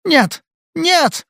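very high, frightened cry; hoarse cry. A narrator's reading. Drugged girl jolted awake by fear, hoarse cry. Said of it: "крикнула она хрипло".

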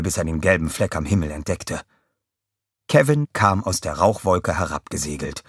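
usual speaking voice

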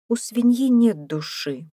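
high woman's voice raising an objection; objecting. A narrator's reading. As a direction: contradict firmly, pitch lifted. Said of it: "возразила Мэйвис".

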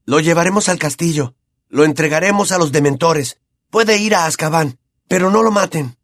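put-on high, insistent boy's voice; lightly voiced young Harry; breathless urgency. A narrator's reading. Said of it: "jadeó Harry".

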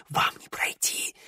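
slow breathy whisper. Acted. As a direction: hiss the warning in fear. Terrified whispered warning.

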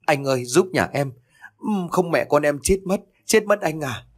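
a hint of sobbing, pleading; mother begging the militia chief, tearful; tearful plea. A narrator's reading. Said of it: "mếu máo nói trong nước mắt".

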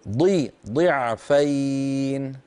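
ضعفين with a slow, heavy, emphatic ض; ضي drawn out, then ضعفين with a full ض; clear dad sound. Shown as a demonstration. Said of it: "اكسري الضاد جيدا".

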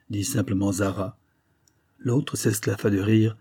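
usual speaking voice

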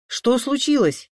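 high, loud, urgent question; alarmed shout. A narrator's reading. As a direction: call out in alarm as you rush in. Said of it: "крикнул Саша".

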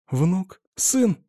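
high, urgent, anxious asking; eager anxious questions. A narrator's reading. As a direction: ask breathlessly, dreading the answer. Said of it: "жадно спросила".

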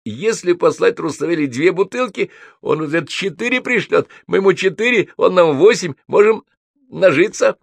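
high-pitched excited scheming; jabbering drunk. A narrator's reading. Гнедич schemes to profit from champagne bottles, jabbering. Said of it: "затараторил Гнедич".

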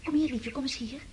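hushed, breathless whisper at the moonlight. Acted. Girl at the window whispering to Sonja about the moon. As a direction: whisper it in awe.

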